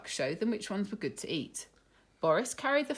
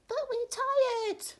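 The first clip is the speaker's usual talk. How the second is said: very high, slow squeaky whine; tiny tired mice; slower than usual. Acted. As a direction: squeak it out wearily.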